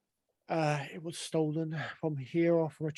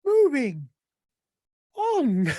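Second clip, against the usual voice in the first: loud, firm "moving on"; insistent; 6 semitones higher, 5 dB louder, much slower than usual. Real, not acted. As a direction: push the scene forward. Louder repeat.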